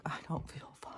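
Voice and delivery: breathy hushed whisper, barely voiced; whispered reply. She whispers back to her inner voice that she feels bad.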